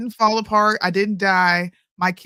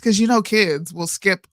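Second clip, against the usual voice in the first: ordinary delivery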